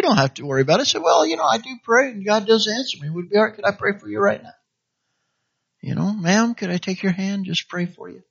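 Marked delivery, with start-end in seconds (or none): 1.0-4.5 gentle polite offer. Retold or imitated. Preacher voices a polite prayer offer. 6.1-8.2 softer, tender ask to a stranger; soft polite request. Retold or imitated. Voiced offer to take a hand.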